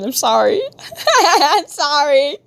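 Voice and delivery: words breaking up into laughter, pitch climbing; laughing apology. Real, not acted. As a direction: apologise through real giggles.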